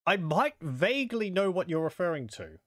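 ordinary talk, nothing marked

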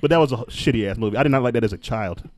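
usual speaking voice

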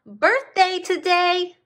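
very high, loud squeaky ray voice; excited ray. Acted. Ray reporting the birthday, high squeaky voice. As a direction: announce it in a tiny high cartoon voice. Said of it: "reported the ray".

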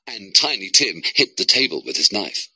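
usual speaking voice

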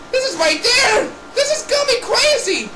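high-pitched hype; excited commentary. Pretend. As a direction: build the excitement for the next move. Hyping the next move.

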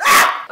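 sudden loud "ah!"; real startled cry; startled yelp. Real, not acted. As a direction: burst out a sharp yelp. Startled while handling hot waffle maker.

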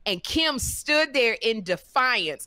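ordinary delivery

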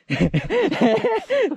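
squealing happy laugh; high laugh. Real, not acted. Mừng vì bẫy kẹp đuôi. (tiếng cười the thé)

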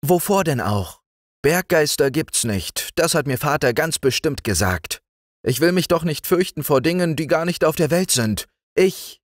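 put-on high, bright child voice; cheerful young girl talking, pitched well up; little girl Liesel. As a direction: speak up like a fearless little girl. Liesel insists she is not afraid.

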